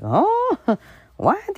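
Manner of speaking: stunned disbelief; mock shock. Uncle hearing about money troubles, high baffled. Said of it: "looking like".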